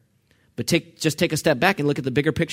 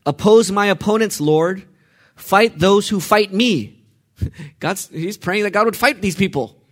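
high, loud impassioned psalm prayer building to a shout; preaching at full force; 4 semitones higher, 3 dB louder than usual. Acted. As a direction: pray it like a wronged man demanding justice. Voices the psalmist's furious prayer.

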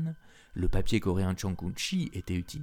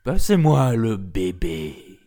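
loud, slow beastly demand; tiger's demand; 7 dB louder, slower than usual. A narrator's reading. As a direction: growl it out slowly, louder. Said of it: "À la demande du tigre".

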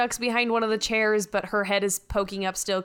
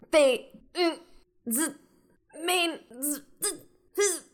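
broken word fragments with buzzing stutters; glitching; 7 semitones higher than usual. Acted. Computer woman glitching mid-seizure on the bridge, stuttering fragments. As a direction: stall and sputter like a malfunctioning computer. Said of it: "looks like she's glitching a little bit".

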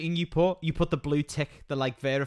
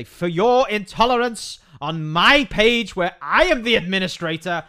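slow, loud, self-important pronouncement; mock official fine; 6 semitones higher, 7 dB louder, slower than usual. Friend voices a fake fine sent to the woman, slow and loud. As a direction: read it like a pompous official notice. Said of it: "send it to her on Facebook and say".

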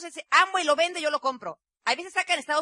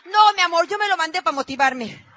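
laughing confession; 5 semitones higher, 10 dB louder than usual. Retold or imitated.

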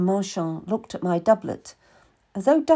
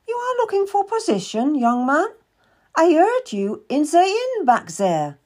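a much higher, brisk merchant woman's voice; Madame Marchand; 8 semitones higher, 4 dB louder than usual. Acted. Madame questions the boy seeking work, higher businesslike voice. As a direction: play a shrewd, businesslike older lady.

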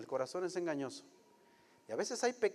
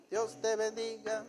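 Dios te bendiga drawn out slowly, a bit higher, syrupy; mock blessing; 5 semitones higher, slower than usual. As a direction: stretch out Dios te bendiga like a sugary jab. Repeated with mock sweetness.

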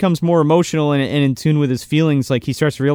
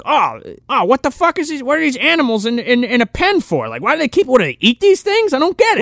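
higher, bewildered soldier voice; hammed-up confused goon; mock baffled soldier; 8 semitones higher than usual. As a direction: play a clueless trooper puzzled by dogs.

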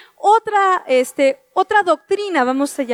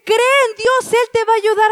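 higher, lightly mimicking a cheery friend; well-meaning friend; 6 semitones higher than usual. Someone telling a troubled person to trust God, lightly mimicked and higher. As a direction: echo a glib bit of encouragement, pitched higher. Someone offering easy comfort.

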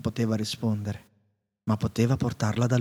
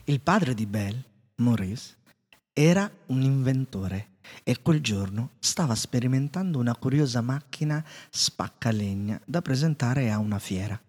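ordinary delivery, nothing marked

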